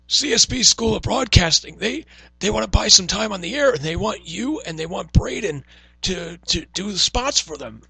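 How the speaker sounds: loud, high, slow caricature of a salesman; hammed-up pitch, drawn out and pushed high; John Laurinaitis. Salesperson pitching radio spots to young staff, mocking impression. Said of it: "make him sound like John Laurinaitis".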